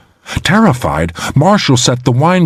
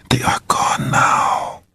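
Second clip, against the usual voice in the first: soft slow whisper close in; woman whispering in her lover's ear; 6 dB quieter, slower than usual. A narrator's reading. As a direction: breathe it into his ear. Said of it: "whispering in his ear".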